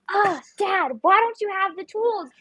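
high panicked complaint to dad; yelling at dad. Retold or imitated. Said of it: "Why are you yelling at me?".

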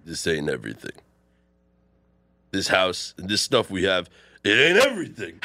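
Rocky from low mumble to a shout; Rocky building up. Acted. Rocky defending his choice, building to a shout. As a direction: start low, then yell into the mic. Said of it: "That was good".

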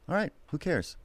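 high, light shrug in the voice; acted breezy indifference; carefree. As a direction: toss it off softly and high. Acts carefree mindset before audition.

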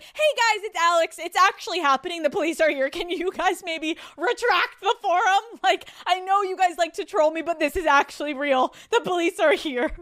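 high, loud, panicky mock pleading; spiralling plea. Spiralling poster pleads with Reddit trolls, mock panic. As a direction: beg them in a hammed-up panic.

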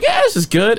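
mildly high-pitched, upbeat self-quote. Retold or imitated. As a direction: lift it up, pleased with yourself. Acts out contented sober self.

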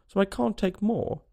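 high, drawn-out huffy reply; offended. A narrator's reading. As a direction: answer slowly, taking offence. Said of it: "in an offended tone".

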